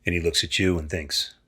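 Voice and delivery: ordinary talk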